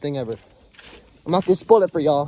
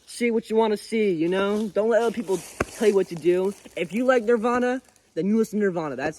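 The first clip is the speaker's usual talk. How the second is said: usual speaking voice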